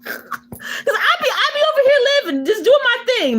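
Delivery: high, fast words breaking into laughter; real laughter, words squeezed out high; laughing through words. Real, not acted. Laughing to chat about her own obliviousness, high and giggling.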